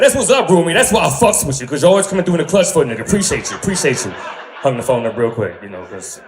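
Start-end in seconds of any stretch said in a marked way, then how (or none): 0.0-4.1 high, loud and fast, laughing; loud hype thanks. Retold or imitated. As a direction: shout your thanks hyped up. Loud grateful reply to grandma.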